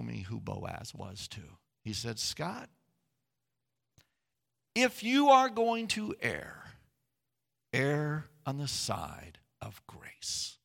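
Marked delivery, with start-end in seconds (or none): 2.2-10.6 mentor's slow, weighty advice; slow gentle counsel. Retold or imitated. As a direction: lay it down slowly, like a wise mentor. Mentor's words, quoted slowly.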